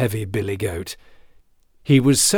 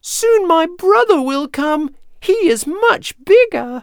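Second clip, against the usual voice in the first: very high, louder little squeak; frightened small goat squeal; tiny goat pleading; 6 dB louder than usual. A narrator's reading. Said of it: "in his tiny voice".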